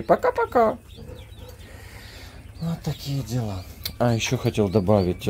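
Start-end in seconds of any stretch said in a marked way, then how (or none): 0.0-0.8 squeaky praising a dog. Real, not acted. Prompting dog to wave.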